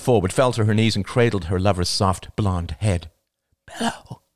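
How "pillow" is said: voiceless, breathy gasp. A narrator's reading. Man fighting for air, asking his girlfriend, gasping. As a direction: force the word out on a gasp. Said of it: "he gasped".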